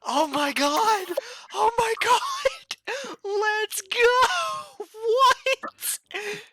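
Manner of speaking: very high, loud drawn-out squealing; shocked excitement. Real, not acted. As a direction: shriek it, stretching the words in real disbelief. Players react to sudden combat.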